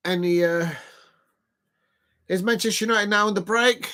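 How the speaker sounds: normal talking voice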